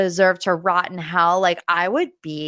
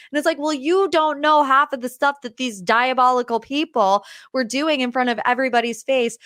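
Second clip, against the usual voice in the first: ordinary delivery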